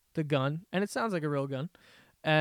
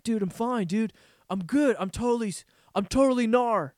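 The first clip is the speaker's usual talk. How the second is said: mock dude voice; 5 semitones higher than usual. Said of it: "And he's like".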